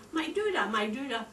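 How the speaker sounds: put-on high little toddler voice on doodah; imitating the baby. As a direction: say it like a small child asking for her soother. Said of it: "so the baby was saying".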